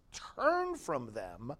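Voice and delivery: usual speaking voice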